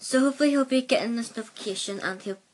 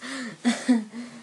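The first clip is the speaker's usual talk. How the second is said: embarrassed laugh. Real, not acted. Laughing at his own slip.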